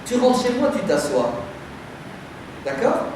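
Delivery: ordinary talk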